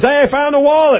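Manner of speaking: excited. Retold or imitated. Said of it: "someone, like, goes".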